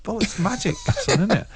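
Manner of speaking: playful smug answer through a laugh; smug mock reply. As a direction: tease him like a conjurer. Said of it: "And I went".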